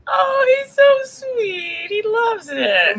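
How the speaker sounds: high, slow, adoring coo. Real, not acted. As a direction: gush over a sweet dog, drawing words out. Cooing over the dog.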